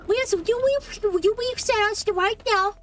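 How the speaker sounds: high, urgent, whiny begging; high pleading. Real, not acted. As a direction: plead frantically for a reset. Begging partner to reset.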